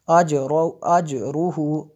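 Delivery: अजरूहू with a bouncing echo on the ज, slow; qalqala. Shown as a demonstration. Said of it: "कलकला होगा". (अजरूहू में ज पर कलकला)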